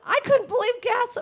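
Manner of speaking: ordinary delivery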